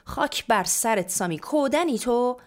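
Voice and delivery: mother snapping loudly at her daughter; angry yelling. A narrator's reading. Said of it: "مامان سرش داد زد". (داد زدن با عصبانیت)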